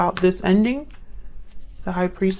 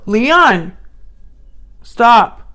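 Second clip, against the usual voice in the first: loud shouted command; real sharp yell at a pet; scolding the dog; 3 semitones higher, 6 dB louder than usual. Real, not acted. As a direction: call out hard to silence a barking dog. Scolding dog that interrupts her.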